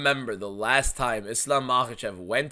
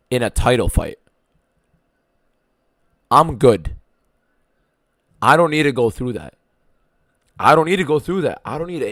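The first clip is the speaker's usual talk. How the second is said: louder, exasperated rant at chat; 13 dB louder than usual. Real, not acted. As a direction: vent it loudly at the whole chat. Ranting about Ian Gary winning.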